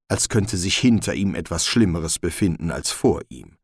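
usual speaking voice

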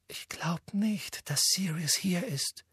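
hushed, fearing his reaction; nervous whispered doubt. A narrator's reading. Hermine dares to doubt Harry.

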